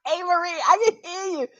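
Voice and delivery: high, laughing and half crying; high laughing call to Marie. Real, not acted.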